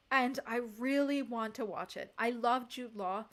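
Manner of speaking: ordinary talk, nothing marked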